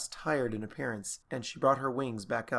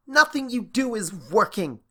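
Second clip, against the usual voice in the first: insistent; 9 semitones higher, 8 dB louder than usual. Acted. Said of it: "Starlight insisted".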